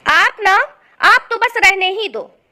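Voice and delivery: loud, huffy dismissal; angry. Acted. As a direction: cut your husband off in a huff. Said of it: "गुस्से में सालनी सो जाती है".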